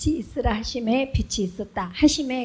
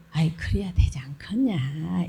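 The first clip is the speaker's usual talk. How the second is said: pleading exhortation; 3 semitones lower than usual. Shown as a demonstration. Pastor demonstrating exhortation to the congregation, slow whispered plea. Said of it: "그다음에 권면해".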